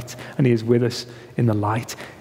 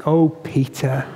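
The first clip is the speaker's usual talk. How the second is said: gentle Jesus; 8 semitones higher than usual. Retold or imitated. Preacher voicing Jesus tenderly to Peter, gentle chiding. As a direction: gently chide a friend who faltered. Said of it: "Jesus' tone of voice was like".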